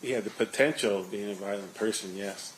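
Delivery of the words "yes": normal talking voice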